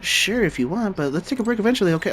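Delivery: high light voice; bright female voice. Acted. Back to the female character.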